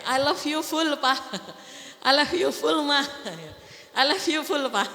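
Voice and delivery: mock-affectionate spouse; playful declaration. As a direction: tease the couples with a sweet call. Acting out loving declarations.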